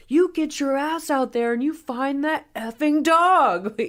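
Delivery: high, loud, drawn-out tirade imitation; mock furious rant. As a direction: rant like you're losing your mind, hammed up. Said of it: "He's just losing his mind".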